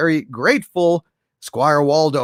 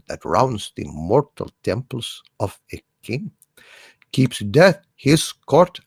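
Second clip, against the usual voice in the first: dramatic recitation; 4 semitones lower than usual. Acted. Streamer reciting Richard II to his audience, solemn and grave. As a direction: recite it like a tragic king mourning.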